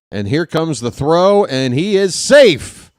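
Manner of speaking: rising excitement on the steal; excited call. Real, not acted.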